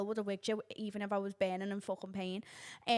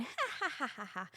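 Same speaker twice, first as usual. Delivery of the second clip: mock cackle; 2 semitones higher, 5 dB quieter, much faster than usual. The old woman walking away, a mock mocking cackle. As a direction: cackle at me like she's winning.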